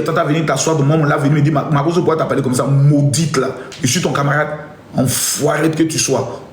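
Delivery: low voice, scolding like a displeased elder; God's stern reply. Retold or imitated. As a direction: answer back as God, deep and dismissive. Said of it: "Voilà la réponse qu'il va me donner". (Dieu qui gronde, voix grave)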